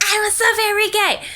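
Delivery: very high, loud imitation of a hearty hello; exuberant mock greeting. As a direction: call it out in an exaggerated high voice. Retold greeting to someone else.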